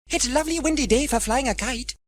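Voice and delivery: put-on bright little girl; girl's voice. Sarah to James in the park, high child voice. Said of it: "said Sarah".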